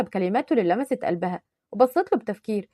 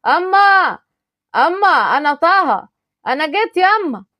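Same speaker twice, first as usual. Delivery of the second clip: calling out loud; 6 semitones higher, 6 dB louder, slower than usual. Acted. Son calling his mother on arrival.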